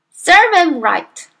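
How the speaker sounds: high, loud, drawn-out judgement; scornful verdict. A narrator's reading. Mother on the boy with glued finger, scornful. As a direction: stretch it out with no sympathy.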